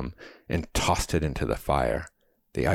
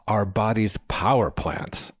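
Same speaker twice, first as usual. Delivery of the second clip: higher-pitched, pleased announcement; proud exclamation; 6 semitones higher, 4 dB louder than usual. A narrator's reading. Young man shows off biology knowledge, proudly exclaiming. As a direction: show off the answer proudly. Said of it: "I exclaimed proudly".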